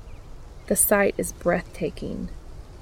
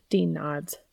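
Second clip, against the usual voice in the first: ordinary delivery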